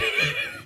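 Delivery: quick, high-pitched burst of laughter; real high laugh; short laugh. Real, not acted. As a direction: let out a brief high giggle. Cracking up over the outfit.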